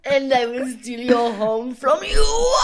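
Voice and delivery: slow, cracking, laughing threat; mock casino villain; hammed-up villain threat. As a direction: overact the menace till it breaks.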